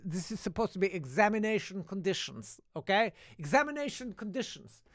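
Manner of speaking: high-pitched mock teacher scolding; flustered teacher. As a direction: imitate a teacher losing control of the class. Teacher scolding over the bedlam.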